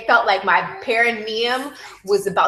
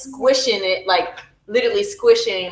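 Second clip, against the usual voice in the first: ordinary talk, nothing marked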